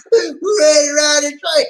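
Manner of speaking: high, loud rallying cry; hyped shout. Pretend. Rallying everyone to ride the train, high excited shout.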